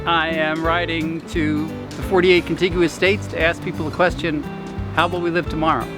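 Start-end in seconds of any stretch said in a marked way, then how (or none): none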